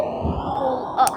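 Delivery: whispered urging. Pretend. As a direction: Pull up whispered urgently, no voice. Urging a toy vehicle upward.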